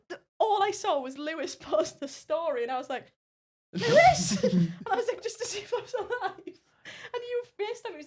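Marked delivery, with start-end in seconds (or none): 3.7-4.4 high, loud indignant call of a name; mock outraged yell. Retelling yelling at friend for posting, loud and high.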